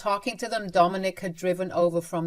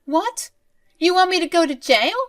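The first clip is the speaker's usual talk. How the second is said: shocked mother; 11 semitones higher, 8 dB louder than usual. Acted. Mother shocked by jail threat.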